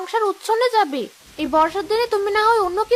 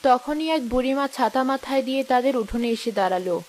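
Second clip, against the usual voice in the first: low, calm storyteller voice; story narration; 7 semitones lower, 4 dB quieter than usual. A narrator's reading. Narrator sets the kitchen scene, low storytelling voice.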